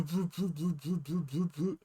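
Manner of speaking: soft, distant booing hum of the rollers; machine noises. Retold or imitated. Describing the automatic car wash, a soft machine hum. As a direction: hum it like the brushes going round.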